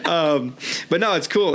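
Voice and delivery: laughs through the words, embarrassed; laughing it off. Real, not acted. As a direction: giggle while brushing off the teasing. Laughing after being teased.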